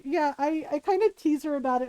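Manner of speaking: ordinary talk, nothing marked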